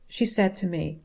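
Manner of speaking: ordinary delivery, nothing marked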